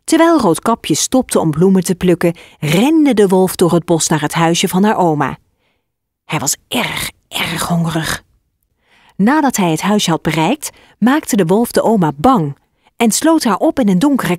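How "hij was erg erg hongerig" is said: whispered, half-voiced menace; storyteller whisper building suspense; hushed whisper. A narrator's reading. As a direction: breathe it out like a secret. Narrator stresses wolf's hunger.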